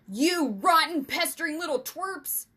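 loud, high bellow of outrage; enraged vice principal roaring at pranksters; angry bellow. A narrator's reading. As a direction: bellow furiously into the night. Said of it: "the vice principal bellowed into the night".

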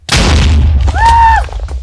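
high startled scream. Real, not acted. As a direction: shriek in fright. Sudden scare in game.

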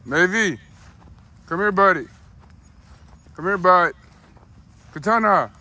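loud high call to the dogs. Real, not acted. Calling dogs back.

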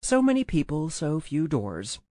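ordinary delivery

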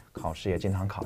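normal talking voice

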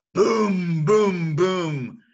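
loud, high booming thunder; thunder booms. Shown as a demonstration. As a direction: boom out like thunder. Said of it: "Mister Brown makes thunder".

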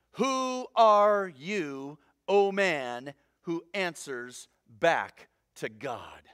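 slow, drawn-out words, dropping away at the end; stern rebuke. Acted. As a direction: space out each word like a solemn rebuke. Delivers Paul's stern rebuke.

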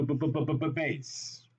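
rapid-fire repeated "b" before landing on "base"; playful stutter. Shown as a demonstration. Teacher giving students a memory hook, playful stuttered "b" into "base". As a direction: bubble out a string of buh-buh-buh into "base".

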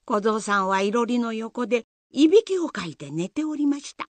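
ordinary talk

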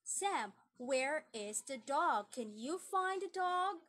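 ordinary talk, nothing marked

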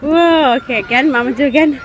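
loud, high play-along; excited play voice. Real, not acted. Mom pushes toddler on swing again, excited and loud.

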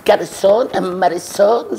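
slow, loud drawn-out call for the waiter. Acted. Wife calls the waiter loudly in a café, drawn-out call. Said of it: "صوتك، صوتك واصل لدبوك".

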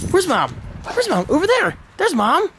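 high, excited sing-song to the dog; pet-talk voice. Real, not acted. Owner asking the dog where Mom is, high excited sing-song.

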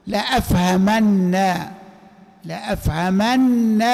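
لأفهمن with the final ن doubled and held, then a short "na"; doubled final nun. Shown as a demonstration. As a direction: stretch the doubled ن in لأفهمن slowly. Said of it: "نونان في بعضهما". (لأفهمن بنون مشددة مفتوحة)

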